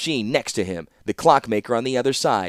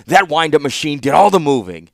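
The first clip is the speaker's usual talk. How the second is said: louder, contemptuous shout; loud scorn; 4 semitones higher, 8 dB louder than usual. A narrator's reading. Warlord dismissing the machine, loud and scornful. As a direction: hammer each word.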